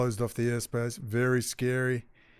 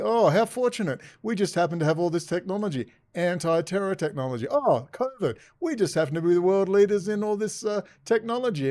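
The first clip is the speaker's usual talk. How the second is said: higher mock wonder; hammed-up mock amazement; sarcastic; 6 semitones higher than usual. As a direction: feign delight, pitched up. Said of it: "I'm being sarcastic".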